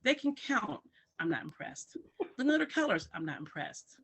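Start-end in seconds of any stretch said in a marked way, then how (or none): 0.0-0.8 imitated boasting parent. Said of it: "parents come to me and say". 2.4-3.1 higher-pitched proud-parent quote; imitated boasting parent. Parents list child's skills to teacher, imitated boast. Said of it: "parents come to me and say".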